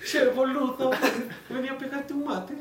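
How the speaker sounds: put-on Argentine accent. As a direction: lightly play an Argentine. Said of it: "acento argentino". (imitación ligera de argentino riendo)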